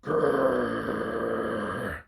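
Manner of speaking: low long growl; bear growl. Acted. Grizzly bear answering Olive with a growl. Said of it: "came a reply".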